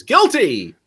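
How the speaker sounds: Judge Judy verdict. Retold or imitated. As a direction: bang out the verdict. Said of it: "when she says".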